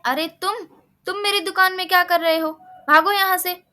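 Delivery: an annoyed shopkeeper raising his voice; shooing shout. Acted. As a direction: chase the mouse out of the shop. Shopkeeper shoos the mouse.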